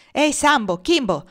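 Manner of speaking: high, loud shout across the yard. Acted. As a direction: bellow for the slaves. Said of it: "gridò altamente Legree". (grido forte e acuto, per chiamare gli schiavi)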